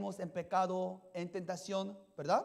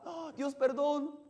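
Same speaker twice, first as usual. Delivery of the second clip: pleading sinner; 6 semitones higher, slower than usual. Retold or imitated. Sinner begging again.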